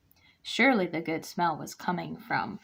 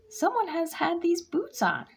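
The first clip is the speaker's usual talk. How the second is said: high, indignant mama bear; huffy; 10 semitones higher than usual. A narrator's reading. Mama Bear finding her boots worn, high indignant huff. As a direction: huff it out, offended. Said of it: "she huffed".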